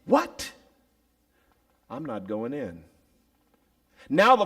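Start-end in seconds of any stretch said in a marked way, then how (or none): 0.0-0.5 high, sharp indignant "What?"; a son outraged at the feast. Acted. Elder son hearing of the feast, indignant. 1.9-2.8 pouting refusal. Acted. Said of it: "began to pout".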